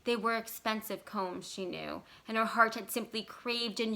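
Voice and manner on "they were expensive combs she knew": usual speaking voice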